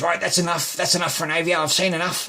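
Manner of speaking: retold courtroom scolding, pitch up; exasperated magistrate. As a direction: act out a magistrate shutting it down. Retold magistrate cutting the argument off.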